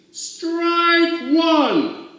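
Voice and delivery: high, loud umpire call; umpire's call. Acted. Umpire calls the strike to the crowd, loud high call. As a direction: bark it like an umpire. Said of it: "the umpire said".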